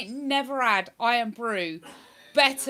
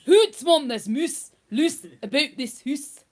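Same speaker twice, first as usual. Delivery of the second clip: Scottish accent; 3 semitones higher, 7 dB louder than usual. Shown as a demonstration. Streamer showing off on request, broad Scottish accent. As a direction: do a comic broad Scots. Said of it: "when I do my Scottish accent".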